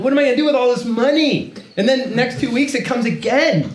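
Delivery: high-pitched, mock-overwhelmed delight; hammed-up giddy excitement. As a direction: relive a newly rich kid's amazement, voice way up. Acts out giddy thrill at sudden money.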